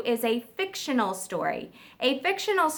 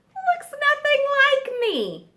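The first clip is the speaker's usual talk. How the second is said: showing off his work; proud giraffe; 13 semitones higher than usual. Acted.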